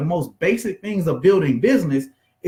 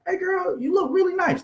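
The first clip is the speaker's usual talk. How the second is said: very high-pitched mock flirt; voice going up; 12 semitones higher than usual.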